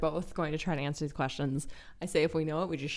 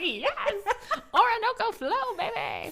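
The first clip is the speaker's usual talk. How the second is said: much higher, laughing cheer; gleeful hammed-up shout; mock excited; slower than usual. Host playing [name] cheering about [name], laughing. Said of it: "It's like".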